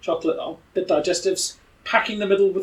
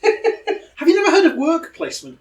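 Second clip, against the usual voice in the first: much higher, louder laughing tease; 12 semitones higher, 5 dB louder than usual. Real, not acted. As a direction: laugh through the question. Teasing Pete, laughing.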